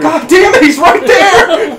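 high, loud, half-laughing panic; alarmed yelling. Real, not acted. Player spotting the monster right there, alarmed yelling. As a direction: yell a frantic warning. Monster appears during chase.

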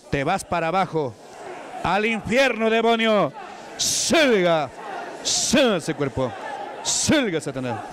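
preacher shouting at Satan to leave; forceful exorcism. Acted. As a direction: drive the devil out with force. Casting the devil out.

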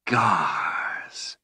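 drawn-out, disdainful dragon; spat with contempt. A narrator's reading. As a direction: spit the name out in one long word. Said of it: "She spat the name".

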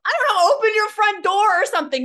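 loud scoffing retort; exasperated outburst. Retold or imitated. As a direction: blurt it out, fed up with the excuse. Exasperated advice to lead-less seller.

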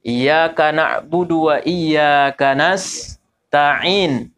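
slow recitation of "Iyyaka na'budu wa iyyaka nasta'in", ain flowing; measured recitation. Shown as a demonstration. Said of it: "mengalir aja ainnya itu". (Iyyaka na'budu wa iyyaka nasta'in dibaca lambat, ain mengalir)

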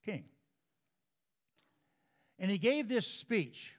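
sermon delivery at full voice; preaching. Acted. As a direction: lift the congregation with each phrase. Preaching builds in force.

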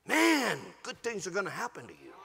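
ordinary talk

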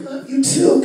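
high-pitched answering lover; mock partner. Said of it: "you're just like".

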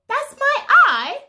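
high, bright mock surprise; overbright kids party host. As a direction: squeal it for toddlers.

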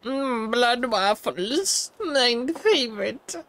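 high, slow, gleeful character voice; put-on relish. Player playing a character fond of blood waffles, relishing. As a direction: savor it in a high, drawn-out silly voice.

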